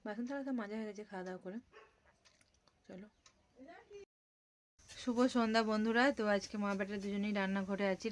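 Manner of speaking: ordinary talk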